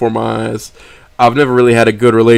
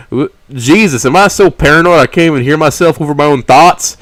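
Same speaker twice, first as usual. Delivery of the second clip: louder, exasperated at himself; flustered outburst; 3 semitones higher, 5 dB louder than usual. A narrator's reading. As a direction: blurt it out in panic. Flustered self-reproach.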